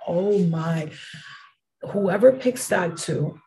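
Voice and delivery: normal talking voice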